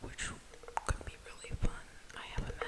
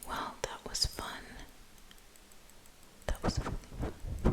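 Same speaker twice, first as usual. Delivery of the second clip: ordinary talk, nothing marked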